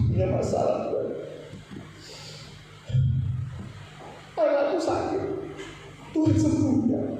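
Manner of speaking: a mild, hushed desperate prayer; pleading prayer. Shown as a demonstration. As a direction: beg God softly for a sick child. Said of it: "Kalau kita doa begini gimana".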